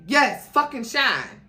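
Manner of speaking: loud, bitter snap of an answer; angry retort. Acted. Jennifer snapping at Sean over Shine, loud and angry. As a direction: throw it back at him, sharp and loud.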